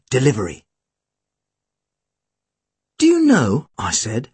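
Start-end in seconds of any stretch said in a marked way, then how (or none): 3.0-3.7 put-on boy hero. A narrator's reading. Boy hero opens dialogue with Tim.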